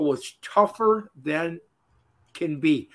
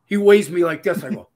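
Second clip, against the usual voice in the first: usual speaking voice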